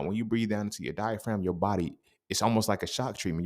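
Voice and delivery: usual speaking voice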